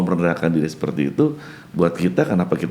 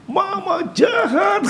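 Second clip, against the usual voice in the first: put-on child's shout; much slower than usual. Grandfather voicing his grandchild shouting at her mother. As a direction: yell it like a hurt small grandchild.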